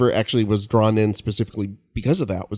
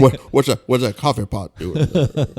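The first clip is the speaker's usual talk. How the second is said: confused [name]; 3 semitones higher than usual. Pretend. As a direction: play a dazed, puzzled man. Voicing [name]'s confusion.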